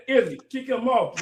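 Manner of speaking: ordinary talk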